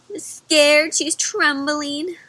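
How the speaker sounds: ordinary delivery, nothing marked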